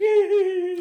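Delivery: very high, loud mock giggle; hammed-up high-pitched titter; smug laughing. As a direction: cackle to yourself, high and silly. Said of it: "smug ass and fucking laughing".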